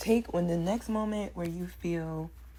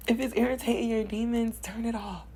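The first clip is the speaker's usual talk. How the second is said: usual speaking voice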